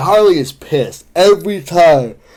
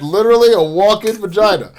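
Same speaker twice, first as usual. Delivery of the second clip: measured mock-presidential cadence, pitched up; Barack; 5 semitones higher than usual. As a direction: lean into a politician impression on "Literally". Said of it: "I did not mean to go Barack".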